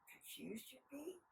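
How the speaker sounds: near-whispered aside; very soft, slow wondering; hushed sympathy. A narrator's reading. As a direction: drop to a breath of a voice.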